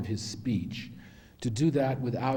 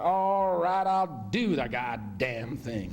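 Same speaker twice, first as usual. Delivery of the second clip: grudging surrender; 3 semitones higher, much slower than usual. Retold or imitated. Actor finally agreeing to the part, retold exasperated surrender. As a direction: cave in angrily, dragging out the last words. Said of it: "he slammed the script shut and said".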